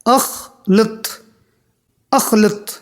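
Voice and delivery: अखलुत said very slowly, syllable by syllable; slow teaching demo. Shown as a demonstration. Teacher introducing a new Arabic word, slow and deliberate. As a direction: spell out अखलुत slowly for the learners. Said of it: "इसको अरबी में कहा जाता है".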